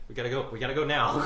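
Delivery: fast, loud laughing urgency; laughing panic. Retold or imitated. Kid scared of monster in room, fast laughing panic. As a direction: rush it out laughing. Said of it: "I was like".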